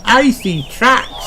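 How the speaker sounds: high, bright little pig voice; high child voice; Peppa's voice. Acted. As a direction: play the excited piglet. Peppa's lines in a children's story.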